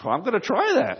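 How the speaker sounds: high-pitched acted eagerness; eager minister. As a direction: jump at the idea in a high voice. Minister eager to copy trick.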